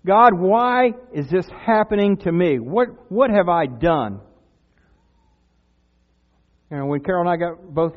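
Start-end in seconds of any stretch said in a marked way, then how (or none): 0.1-4.2 loud, drawn-out cry to God. Acted. Believer in a storm asks God why, crying out loudly. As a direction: plead loudly, stretching the words. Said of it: "crying out to say".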